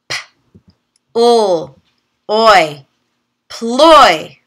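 "P, ul, oi" said as separate sounds, then blended into "ploy"; segment, then blend; sounding out. Shown as a demonstration. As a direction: phonics teacher sounding out. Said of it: "try just the O Y sound".

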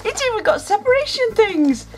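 delighted surprise. Real, not acted. Delighted discovery.

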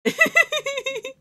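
loud, very high peal of giggles. Real, not acted. Streamer amused by the little character, high giggle. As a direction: burst into high-pitched giggling.

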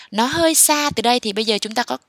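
ordinary talk

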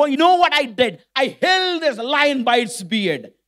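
ringing, high-pitched boast in acted oratory; bold proclamation. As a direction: declaim your heroic deed to a king. Boy proclaims his feat to king.

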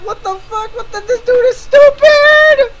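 high scream that climbs to a screech. Acted. Ranting at another YouTuber's claim, screaming. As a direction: shriek it in exasperated disbelief.

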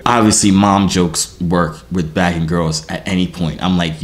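ordinary talk

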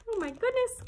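real high, loud exclamation of surprise; amazed. Real, not acted. Reader reacts to the story's picture, amazed. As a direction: react aloud, pitch leaping up.

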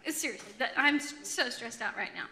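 normal talking voice

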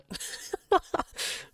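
breathless, voiceless cackle; helpless wheezy laughter; amused laugh. Real, not acted. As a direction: crack up at the silly argument. Laughs at how dumb this is.

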